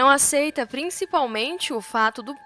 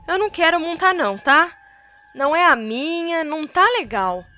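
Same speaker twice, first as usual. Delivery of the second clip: frightened, higher and louder, fast; fleeing, shouting; 5 semitones higher, 5 dB louder than usual. A narrator's reading. Jove flees the horse shouting in fear, fast and loud. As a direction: blurt it out while running away in fright. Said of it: "ele foge correndo e gritando".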